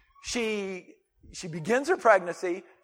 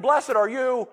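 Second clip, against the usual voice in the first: exclaimed blessing; 5 semitones higher, slower than usual. Retold or imitated. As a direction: ring it out with joy. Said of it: "Elizabeth just puts out".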